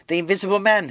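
high, loud shout of alarm; urgent cry. A narrator's reading. Fleeing man shouts to the navvies, high urgent cry. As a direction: cry out a warning, pitched well up. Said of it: "he cried to the navvies".

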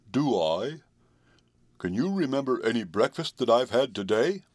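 lightly growled, resentful horse voice; a touch of resentment in a horse's voice; growling horse. A narrator's reading. Horse challenging the boy about breakfast, lightly growled. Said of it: "growled Jim, as if he resented".

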